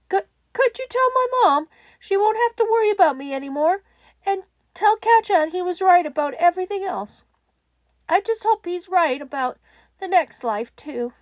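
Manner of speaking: put-on a kid saying goodbye; fragile child voice. A narrator's reading. Child caller leaves a last message for mom, breaking. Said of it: "the voice whispered, cracking".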